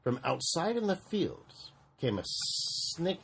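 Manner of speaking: ordinary delivery, nothing marked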